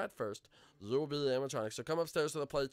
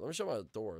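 ordinary talk

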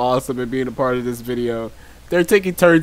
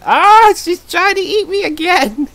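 sudden high-pitched squeal of alarm, louder; panicked yelp; 5 dB louder than usual. Real, not acted. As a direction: shriek as if something grabs you. Being eaten by player in game.

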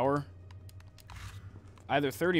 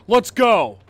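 loud, eager shout; excited yell; 8 semitones higher, 13 dB louder than usual. Real, not acted. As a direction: cheer them on loudly. Excited call to run.

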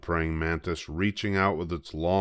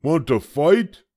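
high, loud, grumpy little bug; a tiny bug spoiling for a fight, high and loud; grouchy ladybug; 10 semitones higher, 8 dB louder than usual. A narrator's reading. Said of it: "said the grouchy ladybug".

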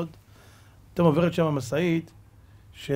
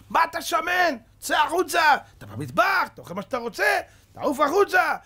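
officer shouting; 11 semitones higher than usual. Retold or imitated. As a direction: yell him out of the kitchen. Officer throws him out shouting.

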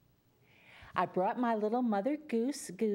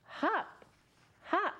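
much higher, springy hop hop hop; high bouncy hops; 9 semitones higher, slower than usual. Shown as a demonstration. Storyteller repeats the chant with the children, in a much higher springy voice. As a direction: leap up on every hop. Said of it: "Everyone again".

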